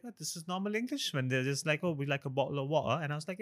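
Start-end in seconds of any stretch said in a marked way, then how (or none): 0.0-1.1 high-pitched mock realization; retold surprise. Host recalls arriving in the UK, mock-surprised high voice. 1.8-3.0 higher-pitched imitation British accent. Host mimics people he met in the UK, British accent. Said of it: "Oh, the British accent".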